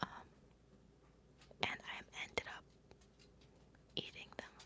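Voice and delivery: breathy ASMR whisper. Real, not acted. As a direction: hush it right into the mic. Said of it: "sorry, let me whisper".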